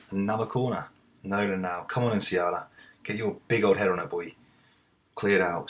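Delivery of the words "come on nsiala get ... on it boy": ordinary delivery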